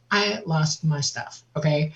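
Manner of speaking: usual speaking voice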